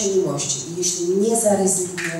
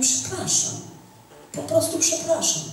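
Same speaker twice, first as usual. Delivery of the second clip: higher, hushed whispered sorry; imitating a husband who comes back in tears to apologise; tearful apology; 8 semitones higher, 5 dB quieter than usual. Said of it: "przyszedł do mnie i".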